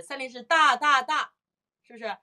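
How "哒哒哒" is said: three equal 哒 in a row; evenly spaced, higher 哒哒哒; even triplet. Shown as a demonstration. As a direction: give each 哒 the same length. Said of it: "但是三连音不是".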